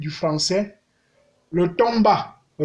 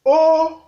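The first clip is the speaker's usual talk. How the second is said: Haut held on a high, level tone; 10 semitones higher, 6 dB louder than usual. Shown as a demonstration. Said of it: "Ça donne ceci". (« Haut » au ton haut)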